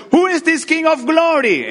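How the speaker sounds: high, loud shout from the crowd. Acted. Someone in the procession shouting a challenge, high and loud. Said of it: "somebody was shouting".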